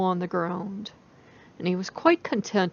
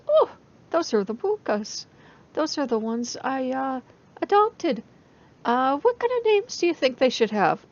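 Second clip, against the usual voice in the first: innocent fox; 6 semitones higher, 5 dB louder than usual. Acted. Loki innocently answers woman.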